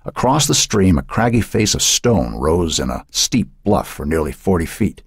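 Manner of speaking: plain reading voice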